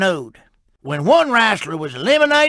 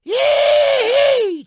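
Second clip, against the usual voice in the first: high whooping yee-haw; gleeful holler. Acted. Tow truck whooping to fire up the crowd, gleeful holler. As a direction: whoop it up to start the show. Stunt show kickoff holler.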